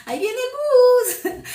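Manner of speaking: very high, loud and slow, 'el bus' drawn out; exaggerated Huila accent. Speaker exaggerating her hometown's long drawl, loud and slow. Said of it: "así".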